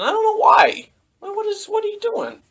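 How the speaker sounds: squeaky, incredulous questioning; high-voiced bafflement. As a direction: ask it in high-pitched disbelief. Baffled by the characters' odd fight.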